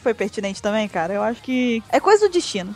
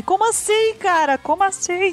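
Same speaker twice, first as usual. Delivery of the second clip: surprised; 8 semitones higher than usual. Real, not acted. Mock outrage at a food dislike.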